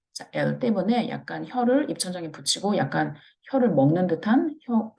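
normal talking voice

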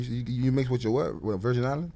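normal talking voice

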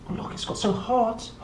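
usual speaking voice

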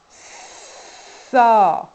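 long loud held sss; held sound. Shown as a demonstration. Teacher demonstrating a long sss, loud and held. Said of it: "vous faites la même chose".

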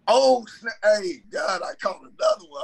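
high, fast squeal of surprise; thrilled surprise. Retold or imitated. Preacher telling God of a second fish, thrilled. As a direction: gasp out the news of a second catch.

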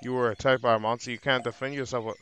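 ordinary delivery

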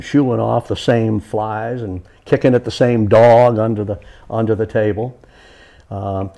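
amble along like a Southern porch storyteller; Coswell Timms, Southern accent. Acted. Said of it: "something that Coswell Timms might have done".

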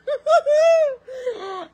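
high cackling laugh. Real, not acted. Laughing at the memory with her friend, high cackle.

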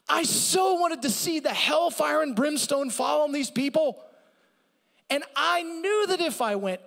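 high, loud griping complaint; petulant Jonah. Acted. Preacher voicing Jonah griping to God, loud and high. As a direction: whine it out like a bitter prophet. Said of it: "I'm doing a slight paraphrase".

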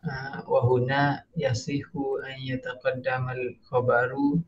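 normal talking voice

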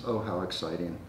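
ordinary talk

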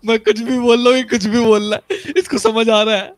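high, loud, laugh-filled words; laughing while talking. Real, not acted. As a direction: speak through giggles.

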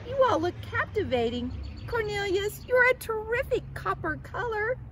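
high, loud admiring gush; thrilled storybook butterfly; excited cry. A narrator's reading. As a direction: gush over friends at top pitch. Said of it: "cried Clara".

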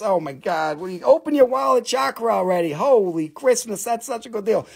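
usual speaking voice

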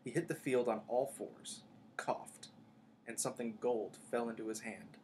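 ordinary delivery, nothing marked

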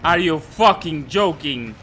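loud, high frustrated outburst; upset. Real, not acted. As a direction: rant after a jumpscare death. After being caught by monster.

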